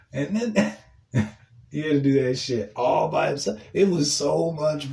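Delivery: laughing through the words; real cackling amusement breaking up the sentence; gleeful laughter. Real, not acted. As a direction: barely get it out for laughing. Laughing at coworker's plight.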